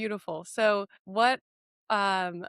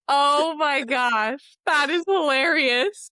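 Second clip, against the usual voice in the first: loud, high, laughing exclamation; cracking up in real amusement; laughing hard; 8 semitones higher, 8 dB louder, slower than usual. Real, not acted. Listener cracking up at the story.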